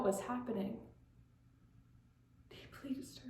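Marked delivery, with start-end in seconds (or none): 2.5-3.3 very soft, voiceless whisper; barely audible whisper; whispered echo. Acted. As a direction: whisper it to yourself. Hushed echo of her phrase.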